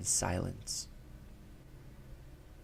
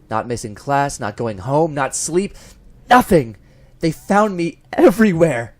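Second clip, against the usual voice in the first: from firm to loud and high; each item louder until he's yelling; a desperate build to a cry; building to a shout; 4 semitones higher, 9 dB louder than usual. A narrator's reading. List of failed escapes builds.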